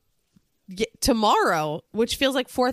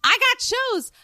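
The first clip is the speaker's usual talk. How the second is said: high-pitched brazen butting-in; Sonia. Retold or imitated. Mimicking Sonia plugging her own tour, high and brash. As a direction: pipe up shamelessly to plug yourself.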